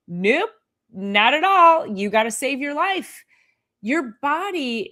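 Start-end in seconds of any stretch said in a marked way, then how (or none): none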